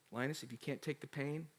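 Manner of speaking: low, soft and measured; quiet encouragement. Retold or imitated. Parent to hurting child after he cried, quiet encouragement. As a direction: gently offer the challenge, low and hushed.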